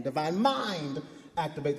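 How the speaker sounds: MIND stretched out and stressed. Shown as a demonstration. Preacher showing congregation the second take, stress on mind. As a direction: lean long on the word mind. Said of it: "The second one".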